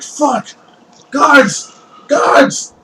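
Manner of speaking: high, loud, desperate calls, the last one breathy; panicked shout for guards. Acted. Fleeing thief calls for the town guards, high panicked shouts. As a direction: yell for help while running.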